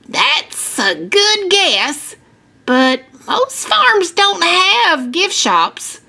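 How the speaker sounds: lightly voiced patient farmer; gentle correction. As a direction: let a child down easy, slowly. Farmer kindly corrects a child.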